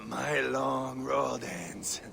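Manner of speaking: slow, faint, breathless whisper; dying warrior whispering his farewell. Acted. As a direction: fade out on each word. Dying warrior's last words.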